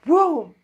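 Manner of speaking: loud, high whooshing "whoo"; whoosh of flying hat. Retold or imitated. Showing how the hat flew off, loud whoosh. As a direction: imitate something suddenly flying off. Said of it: "I seen it go like this".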